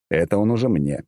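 ordinary delivery

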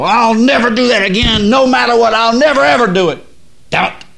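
furious shouted vow, high and loud; mad vow. Retold or imitated. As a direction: yell it like someone fed up with himself. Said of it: "and they go".

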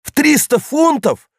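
high, slow outraged cry; shocked cry. Acted. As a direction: gasp it out in disbelief. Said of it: "вскрикнул паспорту". (потрясённый вскрик)

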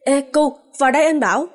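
a narrator's light version of a shout; calling out. A narrator's reading. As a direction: call someone over, lightly lifting the pitch. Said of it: "Hải bỗng kêu lớn".

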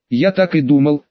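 ordinary delivery, nothing marked

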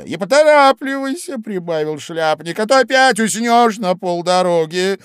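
high, loud, excited Hatter; a lively know-all Hatter; loud, high, bustling Hatter. A narrator's reading. Hatter urges Dormouse.

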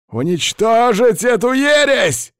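high, loud, slow squealing yell; old man screeching a battle order; shriek. A narrator's reading. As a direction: squeal the order to destroy the heresy. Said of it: "взвизгнул он".

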